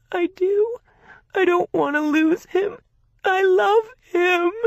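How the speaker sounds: high, loud, tearful wail; sobbing. A narrator's reading. Bride-to-be sobbing to her sister about her fiancé. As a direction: sob it out, clinging to him. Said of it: "then started to sob".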